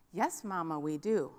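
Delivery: put-on soft, low sing-song kids' chorus; small children answering. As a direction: lightly voice the dutiful little kids. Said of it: "children answer mama".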